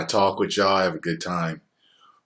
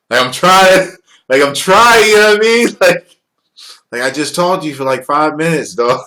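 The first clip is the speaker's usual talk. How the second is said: exasperated; 7 semitones higher, 8 dB louder than usual. Real, not acted. Exasperated at clingy fans.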